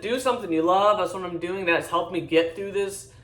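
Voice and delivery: ordinary delivery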